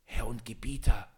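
hushed unvoiced whisper. A narrator's reading. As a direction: whisper it, scared. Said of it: "flüsterte er leise".

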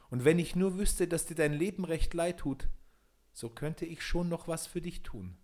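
high-pitched little man, measured offer; little glass man. A narrator's reading. Little glass man offers repentant Peter help, high little voice. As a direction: speak up high as a small forest spirit.

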